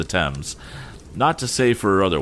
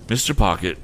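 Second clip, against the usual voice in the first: ordinary delivery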